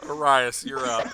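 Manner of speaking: loud, laughing through the words. Real, not acted. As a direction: call the turn while cracking up. Host cracking up mid-call.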